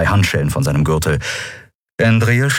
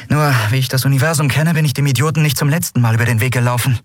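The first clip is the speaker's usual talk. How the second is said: higher-pitched, brisk and lightly voiced; wry heroine; 6 semitones higher than usual. Woman admitting past mistakes to companions, wry. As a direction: rattle it off with rueful humour.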